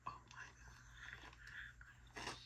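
barely audible whisper; stunned hush; awed whisper. Real, not acted. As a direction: breathe it out in disbelief. Overwhelmed by new camera.

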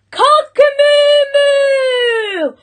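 high, long-drawn shouted rooster crow. A narrator's reading. Cockerel attempting his morning crow, shouted. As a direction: shout it out high, holding the final moo. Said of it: "and shouted".

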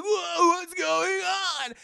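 high, drawn-out cry of alarm; panicked wail. Retold or imitated. Shrunken hero panics.

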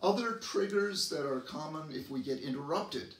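normal talking voice

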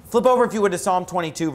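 usual speaking voice

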